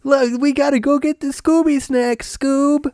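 mocking high-pitched cartoon voice; your own voice held way up high; Shaggy. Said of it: "he made his own voice higher".

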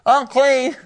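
loud cry of unclean, a bit high; playful shout of unclean; mock cry of unclean. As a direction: call out unclean again as a warning. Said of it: "it's total unclean".

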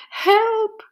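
high, frightened little cry for help; panicked fish calling out from the net. A narrator's reading. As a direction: squeal it out like a scared fish. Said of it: "cried the fish".

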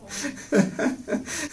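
a high, airy giggle at his own joke; giggle, breath only, way up high; cracking up while telling his own joke; high breathy giggle. Real, not acted.

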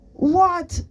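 high, loud yelled answer; shouting back. A narrator's reading. Girl answering her friend's shout from out back, shouting. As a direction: yell it straight back. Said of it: "she shouted back".

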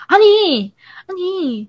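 high, irritated protest, falling away; annoyed brother. Retold or imitated. Brother interrupting mom midway, mock annoyed. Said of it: "you could tell he's annoyed".

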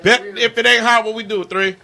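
excited. Real, not acted. Hyping the host bit on air.

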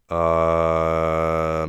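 long, loud monotone drone; mock dumb groan. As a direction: hold a droning, slack-tongued uh. Acting out numb tongue.

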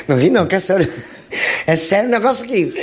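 words through a laugh. Real, not acted. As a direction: try to sound serious while laughing. Laughing while saying serious.

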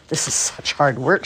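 mildly strained effort; a touch of puffing while straining; huffing and puffing. A narrator's reading. Martha hauling George along, lightly huffing and puffing. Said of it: "huffing and puffing".

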